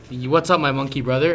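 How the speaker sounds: usual speaking voice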